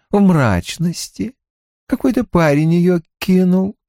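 calm, relishing serial killer; lightly voiced killer Creed. A narrator's reading. (слегка озвученный маньяк Крид)

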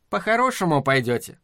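ordinary delivery

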